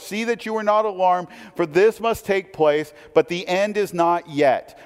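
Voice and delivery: solemn Bible reading; scripture reading. Acted. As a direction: read the passage with gravity. Reads scripture passage aloud.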